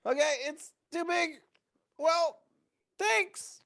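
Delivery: high, thin, awkward retreat; high awkward excuse. Retold or imitated. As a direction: squeeze it out high and sheepish. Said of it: "And then I'm like".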